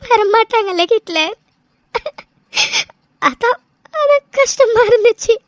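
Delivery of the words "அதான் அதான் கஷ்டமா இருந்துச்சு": words catching on sobs; tearful confession to her husband; crying. Acted. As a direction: push the words out through tears. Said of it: "எதுக்கு இப்ப நீ அலற?".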